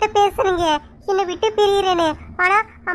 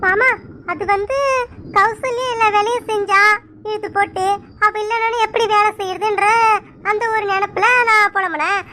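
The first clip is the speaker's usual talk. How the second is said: usual speaking voice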